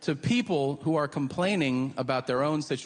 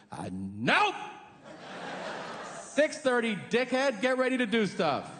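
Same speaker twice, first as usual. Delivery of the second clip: bossy world; 8 semitones higher than usual. Retold or imitated. Comic voicing the world mocking a red-eye passenger. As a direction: snap them awake like a mean alarm. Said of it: "the world goes".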